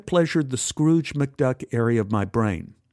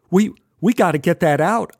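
urgent; 5 semitones higher, 5 dB louder than usual. Acted. Urgent reaction to pinned finger.

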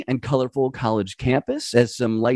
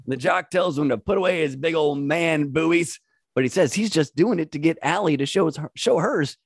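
ordinary talk

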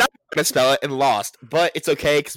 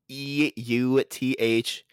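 slow, letter by letter; careful spelling; much slower than usual. Shown as a demonstration. Having a crack at spelling euthanasia, slow and deliberate. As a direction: draw out each letter as you spell.

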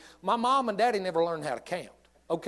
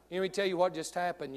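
ordinary delivery

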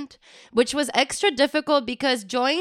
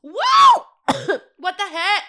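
very high squeal of surprise; shocked; 8 semitones higher, 6 dB louder, slower than usual. Real, not acted. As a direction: yelp it out in shock. Reacting to the big hit.

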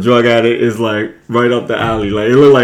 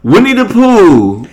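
excited exclamation; 9 semitones higher, 4 dB louder, much slower than usual. Real, not acted. Excited name drop of Pooh.

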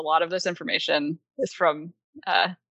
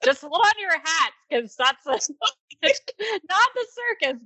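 playful teasing; 8 semitones higher than usual. Acted. Teasing buildup before reveal.

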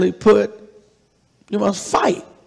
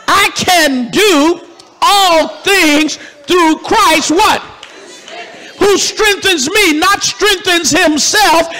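shouting preacher climax; 6 semitones higher, slower than usual. Real, not acted. Preacher proclaiming the verse to the crowd, slow and shouted.

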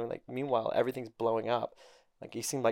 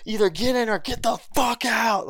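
hyped retold shout; 10 semitones higher, 5 dB louder than usual. His own retold hype shout.

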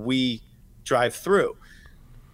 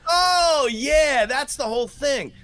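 mock resigned reply; 9 semitones higher, slower than usual.

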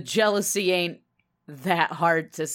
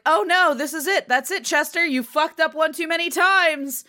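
higher, louder mock alarm at the cat; alarmed family; 8 semitones higher, 4 dB louder than usual. Family catching the cat in the act, mock alarmed. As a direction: scold the cat in hammed-up dismay. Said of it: "everyone's like".

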